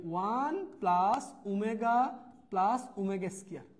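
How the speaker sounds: slow, spaced words as if writing them out; slow dictation. Shown as a demonstration. Teacher dictates a formula while writing it, slowly. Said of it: "দেখো আমি এখানে লিখে দিচ্ছি".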